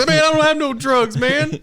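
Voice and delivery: high yelled denial; protesting shout. Pretend. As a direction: suspect protesting during a raid. Acted suspect in raid.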